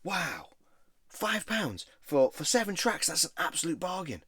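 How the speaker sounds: high, slow, gushing over a bargain; imitating a thrilled friend at the counter; mate's excited voice. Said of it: "he was at the counter going".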